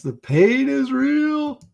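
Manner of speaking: hammed-up lament; mock dramatic. Real, not acted. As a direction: stretch it out like a tragic confession. Dramatic joke about the bad movie.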